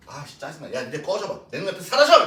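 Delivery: mock irritation building to a loud outburst; annoyed teacher. Teacher mock-scolding quarreling boys, rising to a yell. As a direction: play fed up, then blow up at the end. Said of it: "이런 거지".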